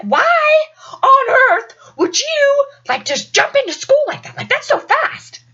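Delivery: high-pitched, drawn-out disbelief; mock astonishment, stretching the words; incredulous. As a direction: demand an explanation in amazement. Classmate's disbelief acted out.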